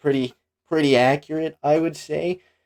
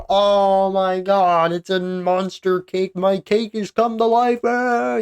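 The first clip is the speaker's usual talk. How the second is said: put-on high, loud, slow panicked child's cry; Billy shrieking at the monster cake, high and terrified; terrified kid; 10 semitones higher, 5 dB louder, slower than usual. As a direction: shriek like a scared little kid seeing a monster. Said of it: "he's like".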